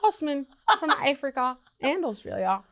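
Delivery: lightly put-on Australian accent, playing a foreign student; fake Australian accent. Student in class introduces herself, lightly faked Australian accent.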